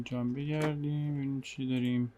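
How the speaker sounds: usual speaking voice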